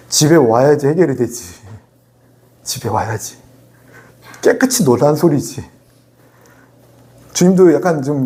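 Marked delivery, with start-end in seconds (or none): none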